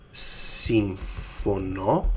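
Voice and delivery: very drawn-out συμφωνώ; slow syllable-by-syllable model. Shown as a demonstration. Teacher writing "yes, I agree", word sounded out slowly.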